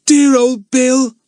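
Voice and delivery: high, loud, slow cry of excitement; Lucy Ann. A narrator's reading. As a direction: cry it out fondly, stretched and excited. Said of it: "cried Lucy Ann in excitement".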